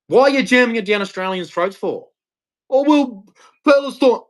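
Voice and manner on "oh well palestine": high-pitched mock whining; mocking protester. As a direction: parrot their excuse in a silly high voice. Mimics a protester's excuse.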